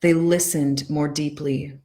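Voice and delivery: each line read slowly with long pauses between; slow poem reading. Shown as a demonstration. Coach reading a poem to her followers, slow and present. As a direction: let every line land before the next. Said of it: "I'm gonna read it really slow".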